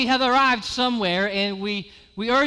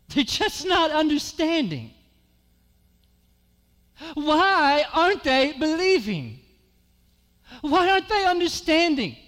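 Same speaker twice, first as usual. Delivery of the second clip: frustrated evangelist; 7 semitones higher than usual. Acted.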